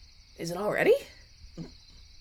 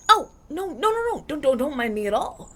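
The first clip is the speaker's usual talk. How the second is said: high, loud no no no; higher and louder, hurried denial; flustered protest; 6 semitones higher, 6 dB louder than usual. Acted. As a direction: wave off the fuss in a hurry. Protesting the fuss over her.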